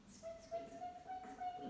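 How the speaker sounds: tiny squeaky mouse voice, very high and soft; mouse squeaks. Pretend. Teacher squeaking like a mouse for the kids in mouse pose.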